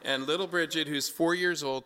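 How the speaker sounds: usual speaking voice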